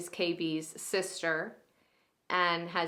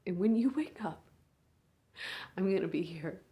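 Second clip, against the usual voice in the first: a big sister at a hospital bedside, swinging between hushed and raw; hushed, aching bedside monologue; grieving big sister; 3 semitones higher, 4 dB louder than usual. Acted. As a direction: speak to your unconscious little sister, holding back tears. Sister talks to comatose sibling.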